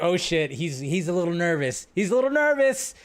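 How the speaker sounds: retold mock alarm, pitch climbing; amused alarm. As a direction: recount your own reaction, rising into mock alarm. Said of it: "I'm like".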